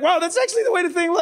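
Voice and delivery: high, rushed wonder; acted-out amazement. Reliving how the demons looked to him, high amazed retelling.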